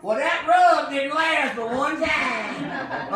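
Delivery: slow, whiny mock customer, laughing; mock complaining customer. Cook mocking a complaining customer, laughing. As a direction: drawl it out like a disappointed customer.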